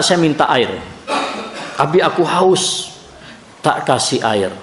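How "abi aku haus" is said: higher, slow, meek voicing of a thirsty child; child's small request. Son after his bath asking his father for water, meek child voice. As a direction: ask for water like a tired little boy.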